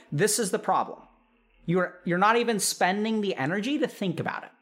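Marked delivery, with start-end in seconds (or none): none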